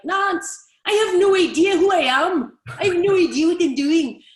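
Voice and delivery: loud, high, hammed-up Scottish accent; bad Scottish accent. Coach mimics player confessing in a meeting, bad Scottish accent. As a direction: blurt it out in a badly faked Scottish accent. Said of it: "that was a bad Scottish accent".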